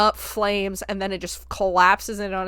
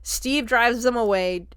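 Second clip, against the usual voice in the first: ordinary talk, nothing marked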